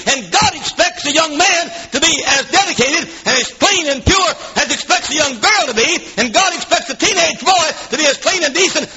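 high-pitched, driving pulpit delivery; impassioned preaching. Real, not acted. Preacher demanding one standard for men and women, impassioned preaching. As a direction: preach it hard in rolling, repeated phrases.

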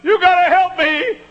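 very high, loud desperate plea; desperate prayer. Retold or imitated. Preacher reenacting his first prayer, high and desperate. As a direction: cry out to God like a frightened young preacher. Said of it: "I remembered how I said".

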